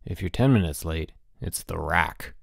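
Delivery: low, solemn warning; grave. A narrator's reading. Bruno warning late roommate of the rack, grave. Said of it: "Bruno explained gravely".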